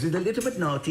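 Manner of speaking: high mock voice of the bloke; mimicking a sheepish performer; quoting the blackface guy. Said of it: "He goes".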